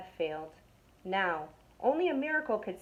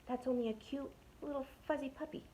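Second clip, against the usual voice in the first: soft and higher, cooing; whispered; 5 semitones higher, 9 dB quieter than usual. Acted. Burglar cooing about the puppy, soft high voice. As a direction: hush it like baby talk. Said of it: "whispered the other burglar".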